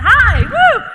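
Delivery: high, loud cheer to the audience; excited whoop. Real, not acted. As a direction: whoop it up with the crowd. Host whooping with the crowd.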